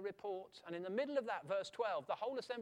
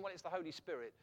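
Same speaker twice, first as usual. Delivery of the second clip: normal talking voice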